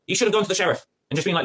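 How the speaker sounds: ordinary delivery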